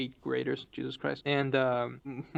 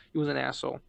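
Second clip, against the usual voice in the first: usual speaking voice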